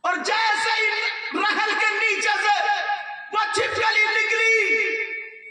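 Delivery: chanted, sing-song sermon delivery; preaching. Acted. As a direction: intone the story to the congregation. Chanted story climax.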